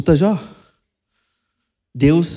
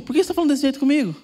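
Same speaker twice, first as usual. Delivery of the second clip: mock offended spouse; 9 semitones higher, much faster than usual. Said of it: "Pode falar pro cônjuge".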